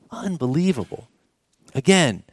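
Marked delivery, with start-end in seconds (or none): 0.1-1.1 soft, hushed unbelievable; hushed awe. Acted. Preacher to the congregation, hushed in awe. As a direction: breathe the word Unbelievable in quiet wonder. Repeated awe, much softer.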